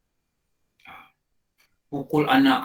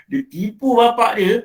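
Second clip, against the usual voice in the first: higher, louder outcry; preacher exclaiming the son lied to his father, higher and louder; indignant exclaim; 8 semitones higher, 8 dB louder than usual. Acted. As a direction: throw it out in shocked disapproval. Exclaiming at the son's deceit.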